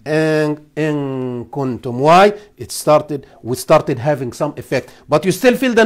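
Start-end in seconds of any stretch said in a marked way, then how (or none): 0.8-2.0 "in kuntum" slowly, the noon hidden toward the kaf; tajweed demo. Shown as a demonstration. Teacher showing students slow "in kuntum" with a hidden noon. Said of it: "But when you continue".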